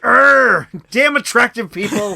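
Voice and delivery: high, loud exasperated groan; envious of the handsome Brit, loud and high; jealous grumble. Retold or imitated. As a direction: moan about attractive people in mock envy. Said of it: "I'm like".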